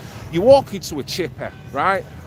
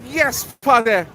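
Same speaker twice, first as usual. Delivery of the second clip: calling out greeting; 7 semitones higher, slower than usual. Real, not acted. Greets Paddy on the stream.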